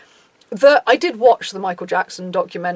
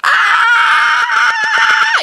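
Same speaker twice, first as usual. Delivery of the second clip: long, very high mock fangirl scream; piercing hammed-up scream; fan scream; 6 dB louder than usual. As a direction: shriek like an overwhelmed fan, loud and sustained. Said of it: "not like".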